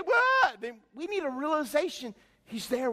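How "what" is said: put-on high, loud childish what; a bratty kid snapping back; whining kid. Acting a stubborn little kid.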